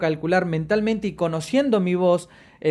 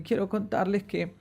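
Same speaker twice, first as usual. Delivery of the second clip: softer and higher, as if about to cry; mock trembling voice; 5 semitones higher, 6 dB quieter than usual. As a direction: imitate a speaker on the verge of tears. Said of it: "parece que van a llorar". (imitando una voz temblorosa, casi llorando)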